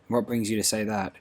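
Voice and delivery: usual speaking voice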